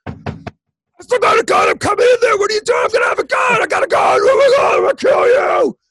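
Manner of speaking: high, raspy screamed threats; deranged ex bellowing through the door; crazed gunman. Retold or imitated. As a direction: scream it like a maniac breaking in. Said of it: "like Jack Nicholson from The Shining".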